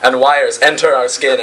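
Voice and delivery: usual speaking voice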